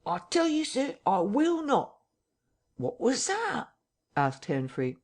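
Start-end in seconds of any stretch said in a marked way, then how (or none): 0.0-2.0 voice rising. A narrator's reading. Mister Bunting refusing the intruder, voice raised high. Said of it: "his voice rising". 2.8-3.7 high-pitched, puzzled question; eavesdropper's question. A narrator's reading. Henfrey to Hall while listening at the door, high and puzzled. Eavesdropper asks what was heard.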